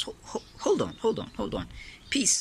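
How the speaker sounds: high-pitched, breathy hurried stammer; startled hold on. Pretend. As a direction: yelp it like you've been caught off guard. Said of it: "we're getting an interruption from Pep's house".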